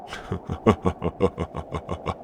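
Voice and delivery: quiet, drawn-out gloating laugh; mocking chuckle. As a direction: chuckle softly at a helpless captive. Captor mocks the trapped prisoner.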